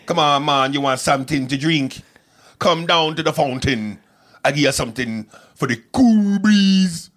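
loud, high sing-song Jamaican accent. Shown as a demonstration. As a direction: call out an invitation in broad Jamaican. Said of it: "I know my Jamaican accent".

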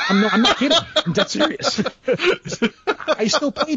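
high-pitched, laughing insistence; real laughing. Real, not acted. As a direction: protest through helpless laughter. Laughs while insisting it's true.

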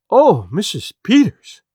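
loud, high, drawn-out cry. A narrator's reading. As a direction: cry out in dismay, stretching the words. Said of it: "she cried".

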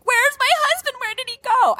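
high, panicked call for her husband; distressed call. Retold or imitated. Screaming in labor, retold.

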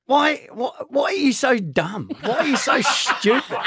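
high-pitched exasperated partner impression; Cherie. Man imitating his partner scolding him, high and exasperated. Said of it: "the one that gets me".

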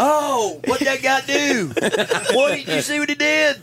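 high-pitched, amazed shouting; Bobby. Retold or imitated. Friend Bobby talking through a movie, amazed high yelling. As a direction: holler to your buddy about the screen. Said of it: "my friend Bobby".

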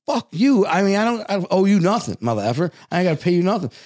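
high, heated comeback; tough-guy pushback to a mob boss; defiant retort. Retold or imitated. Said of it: "And I wanted to say".

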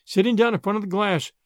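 plain reading voice

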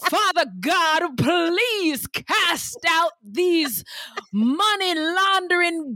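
high, slow, hammed-up church prayer; mock preaching prayer. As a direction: call on Father God like a revival preacher. Mock prayer casting out sins.

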